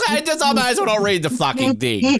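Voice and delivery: high, fed-up mock reply; exasperated. Podcaster retelling his reaction to spoilers, high and exasperated. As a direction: throw up your hands in disbelief. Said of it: "I'm like".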